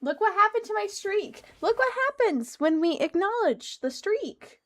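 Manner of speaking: high, loud frustrated outburst; outraged rant. Real, not acted. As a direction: rant at the lost streak. Ranting over lost streak.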